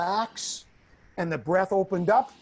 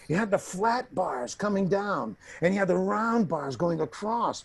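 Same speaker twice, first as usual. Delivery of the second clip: usual speaking voice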